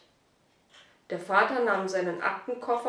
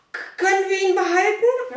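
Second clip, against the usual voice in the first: eager plea; 11 semitones higher, 9 dB louder than usual. Acted. Boy begs to keep puppy.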